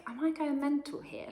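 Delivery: high, disbelieving self-question; incredulous. Retold or imitated. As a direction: ask yourself in disbelief. Inner voice doubting herself.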